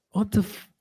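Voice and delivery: high, stunned, half-laughing what; can't believe what he just heard; a high, laughing double take; stunned disbelief. Retold or imitated. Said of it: "I was like".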